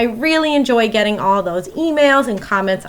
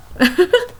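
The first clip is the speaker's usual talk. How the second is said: laugh; 7 semitones higher than usual. Real, not acted. Teacher laughs at own joke.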